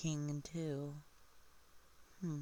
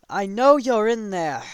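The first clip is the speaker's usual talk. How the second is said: loud, raised voice as a demanding king; calling out; 6 semitones higher, 13 dB louder than usual. Retold or imitated. King calls to someone hiding inside, loud demand.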